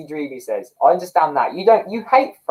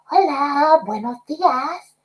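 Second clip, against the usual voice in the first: much higher, slow, disguised prank-call voice; put-on voice; 11 semitones higher, much slower than usual. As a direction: fake a stranger's voice on the phone. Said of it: "I'd put on a voice".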